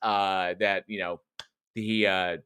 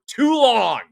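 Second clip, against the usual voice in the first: higher, louder, drawn-out wail; exasperated outburst; 13 semitones higher, 8 dB louder than usual. Real, not acted. Host bemoaning time stuck in this office, exasperated. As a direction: stretch it out in mock agony.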